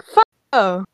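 mock attack cry. Imitates a game character's fire attack.

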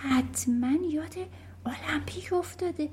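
soft, whispery aside; under the breath. A narrator's reading. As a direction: murmur it to your friends unheard. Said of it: "هورتانسیا زیر لب گفت".

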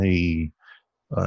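ordinary delivery

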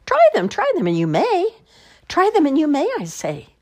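very high, loud coaxing; eager pleading. Acted. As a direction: beg them to just try.

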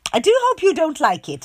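high, loud sweet tone; smiling hostess. A narrator's reading. Mother voicing Miss Contrary smiling at her guest. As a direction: say it with a smile. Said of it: "she smiled".

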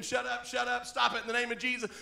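ordinary delivery

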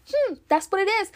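a smug, snap-judgment "hmm"; dismissive mock. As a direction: play someone who judges too fast. Mocking a shallow judgment.

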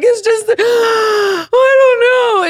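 high, drawn-out sobbing wail; mock crying. Daughter asked why she is crying, exaggerated loud sobbing. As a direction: gasp and wail it, hammed up. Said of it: "What are you crying about?".

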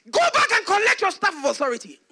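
high, loud fatherly command; shouted order. Retold or imitated. Father sending his son back, shouting the order. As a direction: cut the excuse off with a shout. Father cuts off son's excuse.

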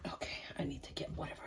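quick whispered aside; whispering. Real, not acted. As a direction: rush it out in a whisper. Aside off-camera while working.

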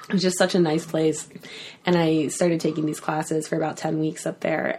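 ordinary talk

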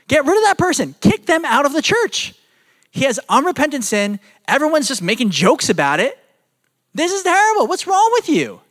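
high, appalled rebuke; indignant Paul rebuke. Retold or imitated. Paul scolding the Corinthian church, high and appalled. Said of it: "this is basically what he says".

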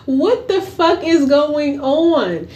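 high, slowing, incredulous; a stunned am-I-being-punked; high and drawn-out disbelief. Retold or imitated. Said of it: "I'm like".

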